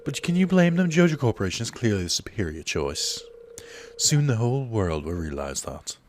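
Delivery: put-on a smug, light Australian accent; oily Australian salesman voice. Joja rep taunting Pierre, in a put-on Australian accent. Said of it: "I don't know why I'm going Australian".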